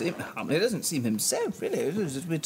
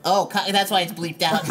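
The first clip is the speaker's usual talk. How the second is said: usual speaking voice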